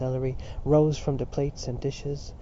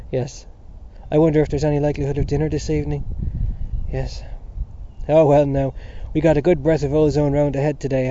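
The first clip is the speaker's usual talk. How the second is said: louder, jovial Irish father holding court; hearty Mr Dedalus; 6 dB louder than usual. Acted. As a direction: boom it out like a genial host at home. Narrator voices Mr Dedalus.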